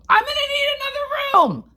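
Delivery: high shout of a frazzled guest; high-pitched frantic shout for a new room; retold outburst. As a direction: yell it out on the stairs, at the end of your rope. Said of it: "which was inappropriate".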